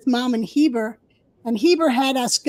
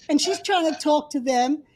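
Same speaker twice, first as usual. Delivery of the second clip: normal talking voice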